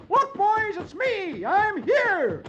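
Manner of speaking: very high, loud cheery yell. Acted. Dagwood yells to his fishing friends, high loud yell. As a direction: holler across the lake. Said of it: "jumps out of his car and yells".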